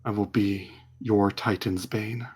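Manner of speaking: soft, low hushed plea of a sword; quiet whispering sword voice. Acted. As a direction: murmur it like a sentient blade. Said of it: "the sword whispers to Euphemion".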